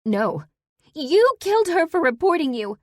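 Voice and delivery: high shrill accusing yell; furious shout. Acted. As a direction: hurl the accusation. Daughter screaming accusations.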